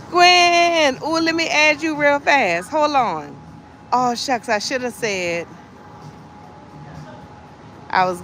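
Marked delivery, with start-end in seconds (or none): none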